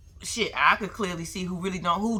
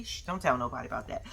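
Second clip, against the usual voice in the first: usual speaking voice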